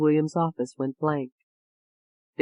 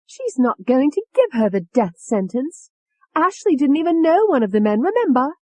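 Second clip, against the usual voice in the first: higher, louder, cocky cockney tone; Tony's voice; 10 semitones higher, 4 dB louder than usual. A narrator's reading. Tony brushing off David's warning, cocky. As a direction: brush it off with a smirk. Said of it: "Tony said".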